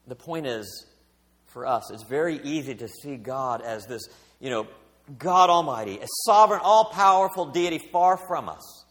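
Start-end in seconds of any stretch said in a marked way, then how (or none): none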